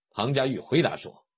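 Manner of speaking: plain reading voice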